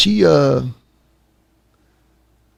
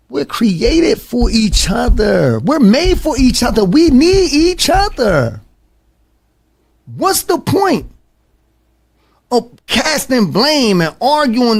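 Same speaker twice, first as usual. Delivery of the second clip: impassioned plea; 9 semitones higher, slower than usual. Real, not acted.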